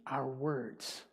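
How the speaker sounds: usual speaking voice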